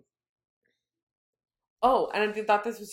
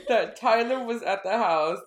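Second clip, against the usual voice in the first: laughing through words; 4 semitones higher, 6 dB louder than usual. Real, not acted. Laughing mid-retelling.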